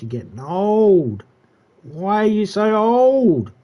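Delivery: slow, drawn-out teasing wail; mock scolding the cat. Real, not acted. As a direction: stretch it out, lamenting at a pet. Teasing the cat about its age.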